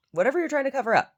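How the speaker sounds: emphatic exclaim. Real, not acted. Ranting about pancake makeup, loud high exclaim. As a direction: blurt it out in disbelief.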